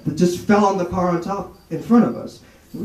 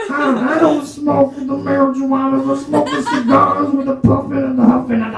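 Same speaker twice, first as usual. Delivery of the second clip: higher, puffing Cosby ramble; Cosby denies smoking, pitched up; Bill Cosby impression; 8 semitones higher than usual. Comedian as Bill Cosby answering [name], high and rambling. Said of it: "conversation between Bill Cosby and [name]".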